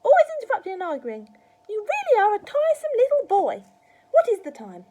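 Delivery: loud, high-pitched grandma tirade; scolding. A narrator's reading. Grandma berating George as a tiresome boy, scolding. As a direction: berate a child at full volume.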